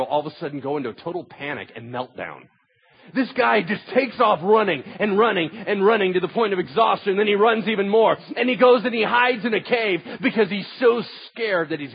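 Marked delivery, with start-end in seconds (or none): 3.1-11.9 high, loud, driving sermon voice; full-force preaching. Real, not acted. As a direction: preach it urgently, piling up the story.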